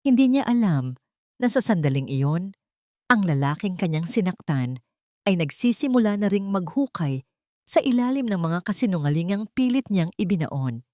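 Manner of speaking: plain reading voice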